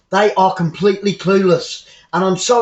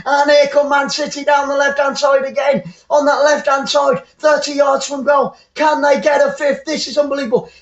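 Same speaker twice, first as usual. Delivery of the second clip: live play-by-play; 6 semitones higher than usual. Real, not acted.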